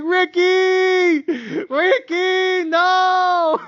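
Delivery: high, drawn-out cry of Ricky; screaming at the TV. Retold or imitated. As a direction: wail his name at the TV. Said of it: "screaming at the T V".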